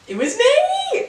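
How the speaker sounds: high, slow, drawn-out put-on voice; mock squeaky confession. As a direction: squeak it out like a guilty cartoon. Mock reveal of the quote's author.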